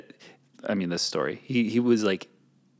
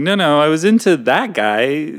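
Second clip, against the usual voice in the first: mock whiny excuse; 6 semitones higher, 7 dB louder than usual. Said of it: "fault the other person for going".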